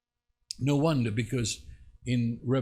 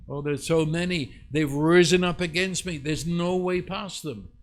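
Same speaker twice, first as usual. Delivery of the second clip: higher, louder mock dismay; overwhelmed lament; 4 semitones higher, 5 dB louder than usual. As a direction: cry out as if hopelessly outnumbered. Acts David's earlier overwhelmed lament.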